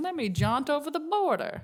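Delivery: mock breezy. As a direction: toss it off like a silly little outing. Mock of a carefree border hop.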